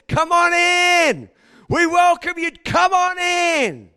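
loud, high, drawn-out welcome; hammed-up crowd cheering him in; mock loud welcome. Crowd welcoming Jesus into the city, mock cheer.

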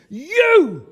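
slow, high, loud scolding command; stern shouted order. Retold or imitated. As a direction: order him inside like a furious wife. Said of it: "she pointed a finger at me and she said".

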